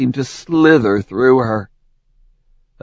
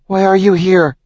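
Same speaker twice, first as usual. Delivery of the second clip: frightened; 10 semitones higher, 4 dB louder than usual. Acted. Frightened challenge.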